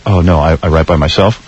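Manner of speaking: low, lightly mocking old rocker; [name] impression. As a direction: drop the voice into a huffy brush-off. Howard quotes [name]' reply.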